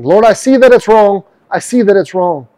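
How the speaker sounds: loud, high, pleading confession; pleading prayer. Pretend. Repentant believer pleading loudly with God. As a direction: cry out to God in remorse.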